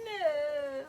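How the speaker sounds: high, drawn little wail; mock-plaintive cry; retold dismayed no. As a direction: let it out high and pained. Reacting to mom's lost dreams.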